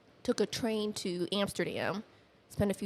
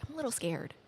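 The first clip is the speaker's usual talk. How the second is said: small, high, half-whispered admission; a touch of fear, pitched up; retold nervousness; 6 semitones higher, faster than usual. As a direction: quote a timid self, lightly. Voices own nervousness before trip.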